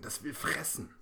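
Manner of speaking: high tearful wail, lightly voiced; little girl beginning to cry. A narrator's reading. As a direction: start to cry on the words. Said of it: "Lena fängt an zu weinen".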